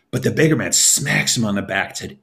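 ordinary delivery

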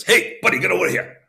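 high, hearty call to a stranger; beggar calling. Acted. Beggar calls the king over to his fire, high and hearty. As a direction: hail him over, high and friendly.

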